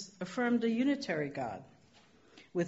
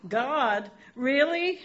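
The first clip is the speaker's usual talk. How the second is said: rising, louder incredulous double take; can't believe who's talking to you; stunned disbelief; 6 semitones higher, 5 dB louder, slower than usual. Retold or imitated. Dangling man stunned that God answered, rising and louder.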